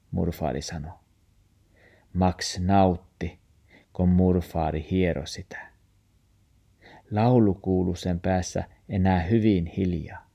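usual speaking voice

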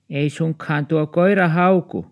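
put-on high, nosy old woman's voice; old neighbour woman. Old neighbour asks about the new dog, high and suspicious. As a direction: peer at the dog and fret. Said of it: "Arja-muori kysyi".

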